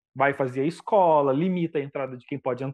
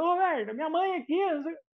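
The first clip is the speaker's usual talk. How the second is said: much higher, mock heckler shouting; mock heckler yell; 12 semitones higher, 3 dB quieter than usual. A rowdy fan heckling Roberto Carlos, high mock yell. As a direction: pitch way up like a rowdy fan calling out. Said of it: "o cara gritando".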